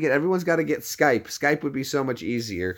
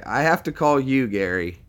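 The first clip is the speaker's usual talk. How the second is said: ordinary delivery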